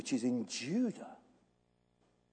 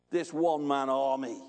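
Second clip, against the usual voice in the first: ordinary talk, nothing marked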